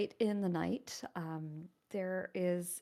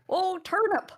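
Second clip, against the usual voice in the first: attempted Southern accent; 4 semitones higher, 4 dB louder than usual. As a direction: play Tucker the farmhand greeting a friend, in a tried Southern accent. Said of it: "'cause I can't do the same accent that you do. I am going to try".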